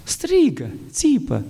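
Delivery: cry out. Acted. Mimicking newborn's cry.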